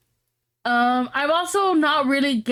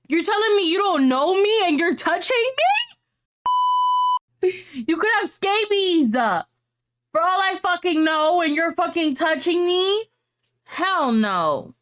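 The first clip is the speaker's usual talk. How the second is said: raised, higher-pitched indignant disbelief; outraged rant; 6 semitones higher than usual. Real, not acted. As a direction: rant at strangers who touch uninvited. Ranting about strangers touching her.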